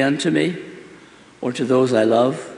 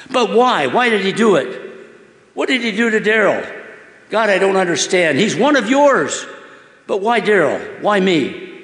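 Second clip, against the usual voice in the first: much higher, pleading why; distraught questioning; 7 semitones higher than usual. Acted. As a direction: demand answers through the hurt. Boy pleading with God.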